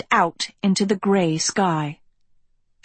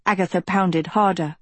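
usual speaking voice

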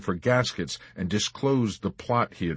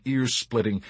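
plain reading voice

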